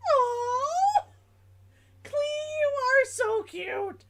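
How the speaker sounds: high, gushing aww over something cute. Real, not acted. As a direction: squeal it like it's adorable.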